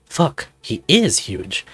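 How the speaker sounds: usual speaking voice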